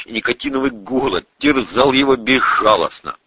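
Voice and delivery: plain reading voice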